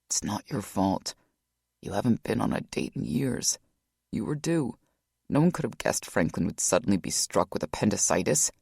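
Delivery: brother consoling his distraught sister; gentle brother. A narrator's reading.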